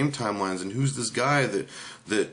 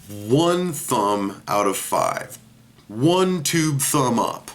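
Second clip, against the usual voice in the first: slow, drawn-out loud rating; verdict announcement; 2 semitones higher, 6 dB louder, much slower than usual. Pretend. Reviewer giving this movie its final score, loud drawn-out announcement. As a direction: stretch each word like a big announcement.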